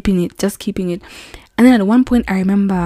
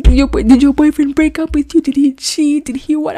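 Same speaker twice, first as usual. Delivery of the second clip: high, mock-panicked string of questions; 8 semitones higher than usual. Policeman guessing why the driver is crying, mock panic. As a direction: imitate someone panicking, voice pitched way up. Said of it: "he was panicking".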